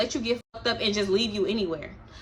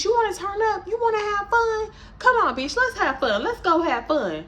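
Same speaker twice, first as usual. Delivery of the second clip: much higher, upbeat egging on; mock party hype; 10 semitones higher than usual. Darnisha hyping the friends to party, in high, excited mock hype. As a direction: fire up the crew.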